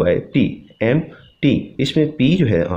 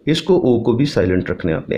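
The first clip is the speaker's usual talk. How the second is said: ordinary talk, nothing marked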